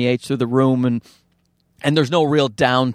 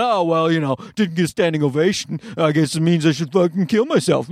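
self-loathing; 3 semitones higher than usual. Retold or imitated. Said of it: "beat the living shit out of myself, going".

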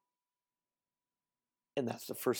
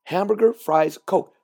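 each item stated crisp, higher and louder; very clear order; 5 semitones higher, 5 dB louder, slower than usual. Shown as a demonstration. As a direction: say it with full clarity. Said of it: "Very, very clear".

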